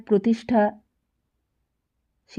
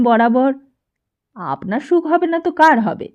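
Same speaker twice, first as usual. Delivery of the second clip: louder, scolding mother's voice; indignant mother; 3 semitones higher, 6 dB louder than usual. A narrator's reading. Mother scolding her son about going to jail, loud and indignant. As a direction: scold the boy for even thinking it.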